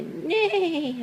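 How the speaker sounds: high wavering neigh, falling away; whinny the word like a horse. Pretend. Parent showing Abby the horse sound, wavering high whinny. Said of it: "said the horse".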